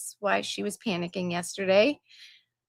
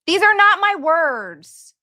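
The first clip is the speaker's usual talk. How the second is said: louder, slow and emphatic, higher; firm disclaimer; 7 semitones higher, 7 dB louder than usual. Real, not acted. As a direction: insist slowly that these aren't your words. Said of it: "I'm just reading it".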